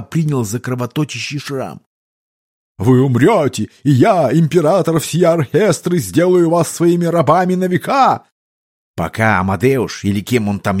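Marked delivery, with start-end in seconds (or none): 2.8-8.2 gurgling alien voice. A narrator's reading. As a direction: boom it like a mad monster emperor. Monster declares itself emperor. (булькающий голос чудовища)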